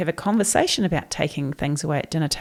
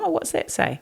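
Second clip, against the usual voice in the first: mock innocent question; 9 semitones higher, 4 dB quieter than usual. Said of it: "you go".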